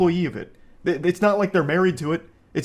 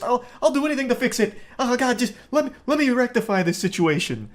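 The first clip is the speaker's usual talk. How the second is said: hammed-up pleading, pitched way up; groveling employee; 9 semitones higher than usual. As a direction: beg like a panicked clerk, voice jumping high. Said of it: "Start groveling like".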